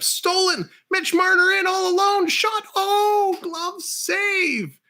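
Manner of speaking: high, loud, thrilled call of the breakaway; excited goal call. Real, not acted. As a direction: scream the big save to the crowd. Breakaway and big save called.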